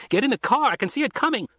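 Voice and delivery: put-on teen boy warning the doctors over the radio, high and urgent; urgent kid on the radio. A narrator's reading. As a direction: play the boy pleading to get in the car.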